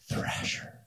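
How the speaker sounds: breathless whisper of the new word; awed whisper. Retold or imitated. Freshman hears of Thrasher magazine, awed whisper. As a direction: whisper it like something sacred.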